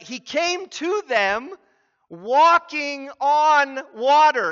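preacher's awed climax; building amazement. Real, not acted. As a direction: make them feel the wonder. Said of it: "building amazement".

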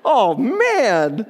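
mild mock dismay after a laugh; a light groaning "oh, man" of surprise; dismayed Jacob. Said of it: "It's just like".

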